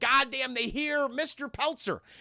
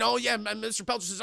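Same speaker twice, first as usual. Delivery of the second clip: ordinary delivery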